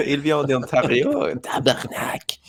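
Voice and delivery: muttered Quebec curse at the end; Quebec French accent. Pretend. Mocking Quebec local.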